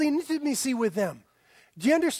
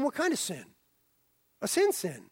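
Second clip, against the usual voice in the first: usual speaking voice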